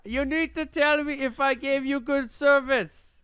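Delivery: high, slow, laughing fake service voice; 1 mock scam agent. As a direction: play the smarmy call-centre agent, slowly. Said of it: "mocking scam caller".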